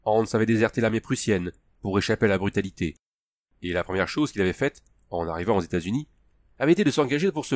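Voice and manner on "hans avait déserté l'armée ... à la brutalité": usual speaking voice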